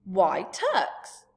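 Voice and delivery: Sophie's indignant loud blurt. Acted. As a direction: fire it back, offended. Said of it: "she blurted out".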